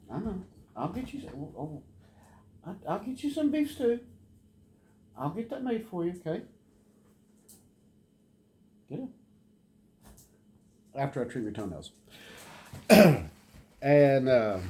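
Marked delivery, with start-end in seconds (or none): 0.1-9.1 high, soft doggy talk; high pet talk. Real, not acted. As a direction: promise the dog his stew sweetly. Cooing to the dog.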